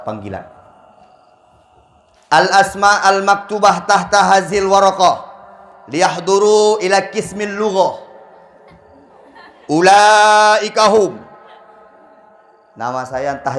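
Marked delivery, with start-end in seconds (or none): 2.3-11.2 loud slow formal announcing; mock Arabic announcement. Announcer reading names in Arabic.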